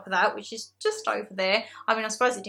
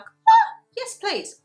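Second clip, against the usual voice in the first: delighted squeal; 12 semitones higher, 6 dB louder, much slower than usual. Retold or imitated. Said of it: "I was like".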